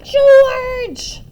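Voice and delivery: put-on piercing, very loud old-woman screech; shrieking summons from an angry mother; high screech. A narrator's reading. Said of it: "a high voice screeched".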